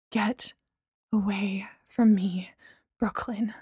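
whispered, slow and cold; hissed whisper. A narrator's reading. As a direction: push her away in a whisper. Angry whispered dismissal.

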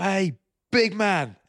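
high, drawn-out mock hail; big, slow, high-pitched hearty hello; boisterous greeting. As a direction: imitate a mate greeting you across a restaurant. Acts out friend's boisterous greeting.

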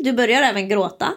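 ordinary talk

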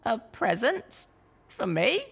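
high, slow, surprised little mare; a small pony caught off guard; young mare. A narrator's reading. Littlepip voiced.

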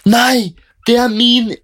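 very high, slow shrieking protest; woman shrieking at a customer who grabbed her ice cream; retold loud shriek. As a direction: shriek it as if your life depends on it. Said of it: "Hun skriker det så jævlig høyt".

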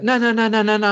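high protesting nos; a kid shaking his head at it; toddler refusing again. Retold or imitated. Son rejecting the chocolate scoop, high protest.

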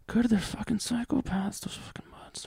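high strained voice sinking to whisper; comic venom, trailing into a voiceless whisper; hammed-up spite at the penguins; mock hatred. Mock rant against dying penguins.

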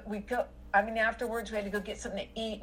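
ordinary delivery

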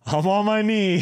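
high, loud playful echo; mock loud declaration. Echoing his fiancée during the proposal, loud and high. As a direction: announce it loudly, hamming it up.

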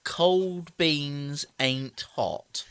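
drawn out word by word; slow, deliberate echo. Retold or imitated. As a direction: stretch each word of the baffling phrase. Baffled slow repeat of partner's odd phrase.

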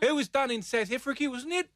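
put-on high South African accent drifting into New Zealand; put-on South African vowels sliding toward New Zealand; New Zealand-tinged accent. Host stays in accent discussing a movie, high South African-New Zealand accent. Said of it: "that's getting into New Zealand now".

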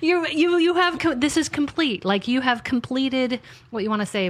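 firm God telling her it's done; the Lord insisting. Retold or imitated. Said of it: "And the Lord was like".

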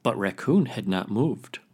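ordinary delivery

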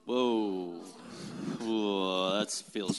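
deep awed whoa. Pretend. Mock awe reaction.

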